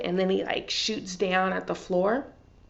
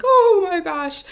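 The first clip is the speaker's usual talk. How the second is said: shocked gasp; 11 semitones higher, 9 dB louder, slower than usual. Retold or imitated. Her reaction while watching.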